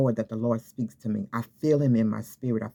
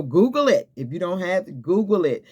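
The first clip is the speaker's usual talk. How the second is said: emphatic urging; 5 semitones higher, 4 dB louder than usual. Real, not acted. Urging viewers to look it up.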